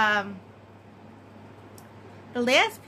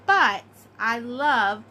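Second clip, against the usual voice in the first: ordinary talk, nothing marked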